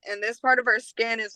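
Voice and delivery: ordinary delivery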